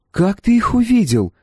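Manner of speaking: put-on high-pitched young woman's question; inquiring girl. A narrator's reading. Girl asking the mercenary about the soldiers, inquiring. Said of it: "поинтересовалась девчина".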